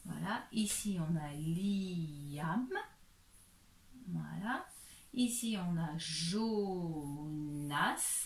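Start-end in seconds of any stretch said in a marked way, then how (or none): 1.3-2.7 "Liam" slow, long i gliding into am; sounded-out name. Shown as a demonstration. Teacher reads the next name, drawn out. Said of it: "il faut attacher". 6.0-8.2 "Jonas" slow, final s pronounced; sounded-out name. Shown as a demonstration. Teacher reads syllables joined, drawn out. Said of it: "on prononce bien le S".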